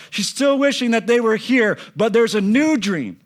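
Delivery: high, loud, driving sermon voice; impassioned preaching. Acted. Preacher telling the congregation pain stays but dreams come, impassioned. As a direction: preach it out with full conviction.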